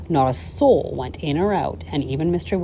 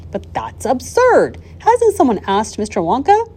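raised, lightly acted boy's objection; incredulous protest; 8 semitones higher, 5 dB louder than usual. A narrator's reading. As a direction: object in disbelief. Boy protesting to his grandfather.

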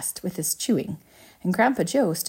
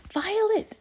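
horrified scream. A narrator's reading. Mother sees Violet change, high screamed name. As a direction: cry the name in panic. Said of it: "screamed Missus Beauregard".

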